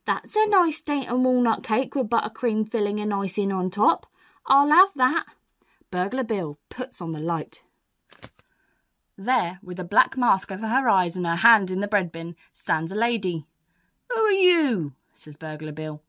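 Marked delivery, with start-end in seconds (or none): 0.0-5.3 raised female-burglar voice; lady thief admiring her haul; mystery burglar. Acted. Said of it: "The voice says". 14.1-14.9 high, loud, falling question; startled challenge. Acted. Burglar confronts the intruder.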